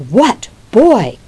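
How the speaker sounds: a hammed-up furious older brother; angry outburst. Brothers about to fight.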